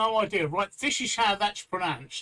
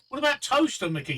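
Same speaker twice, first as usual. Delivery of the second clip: normal talking voice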